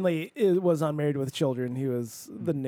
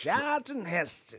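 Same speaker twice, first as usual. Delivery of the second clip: slow, higher-pitched mock screen-actor voice; Charlton Heston; 5 semitones higher, slower than usual. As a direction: draw it out like an old movie star. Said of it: "like, Charlton Heston being an example".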